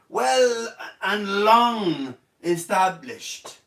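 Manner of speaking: "well and long established" drawn out very slowly, pompous; mock grand. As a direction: read like a royal decree.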